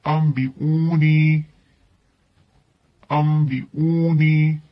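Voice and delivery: अम्बिऊनी slowly, the n sounded as m; drawn-out recitation. Shown as a demonstration. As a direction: stretch अम्बिऊनी out syllable by syllable, m before b. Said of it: "आगे देखिए". (अम्बिऊनी धीरे-धीरे, मीम की आवाज़ से)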